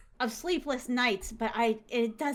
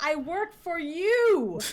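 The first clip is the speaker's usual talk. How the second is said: high, slow mock yell; drawn-out high protest, ending near laughter; acted-out yelling; 7 semitones higher, 4 dB louder, much slower than usual. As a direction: throw it back in a staged quarrel. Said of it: "they started yelling at each other".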